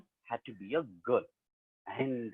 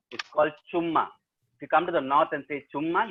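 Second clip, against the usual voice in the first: ordinary delivery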